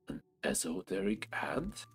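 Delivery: usual speaking voice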